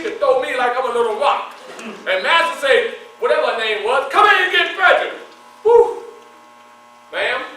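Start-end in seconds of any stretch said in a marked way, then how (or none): 4.1-5.1 loud, higher barked command; hammed-up master shouting for help; master's order. Master ordering slave to seize him. 5.6-6.0 loud, high whistle of alarm; startled high exhale; high loud whew. Retold or imitated. Reaction to big woman approaching.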